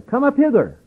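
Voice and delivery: a high, ringing call from above; heavenly voice. Retold or imitated. Preacher echoing the heavenly voice, calling high. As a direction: lift the pitch far up and call them home. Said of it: "saying unto them".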